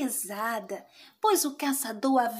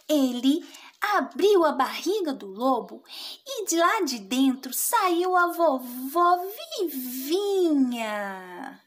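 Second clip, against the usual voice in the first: ordinary delivery, nothing marked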